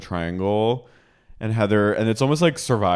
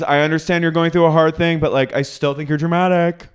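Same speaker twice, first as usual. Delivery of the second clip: high, quick imitated argument; mock defensive insistence; 8 semitones higher, 3 dB louder than usual. Housewife refusing to back down, high mock-argumentative voice. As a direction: concede briskly then dig in, put-on high voice. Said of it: "She goes".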